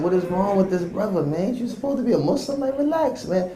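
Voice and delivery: high incredulous grumble; exasperated disbelief. Retold or imitated. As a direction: throw up your hands at this driver. Exasperated at the cab driver.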